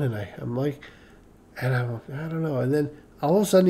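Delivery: normal talking voice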